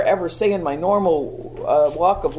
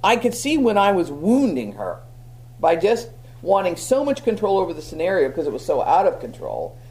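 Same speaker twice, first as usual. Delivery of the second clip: ordinary talk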